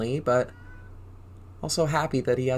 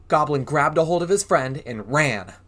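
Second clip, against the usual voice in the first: ordinary delivery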